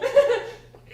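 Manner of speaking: high, loud stunned laugh; nervous laugh. Acted. Wife dumbfounded by husband, high laugh. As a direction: burst out laughing in shock.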